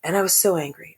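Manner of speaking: ordinary delivery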